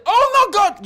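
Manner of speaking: loud, high-pitched mock dismay; mock horrified outcry. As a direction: cry out as if appalled. Acts out horrified reaction to accent.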